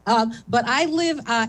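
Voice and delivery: usual speaking voice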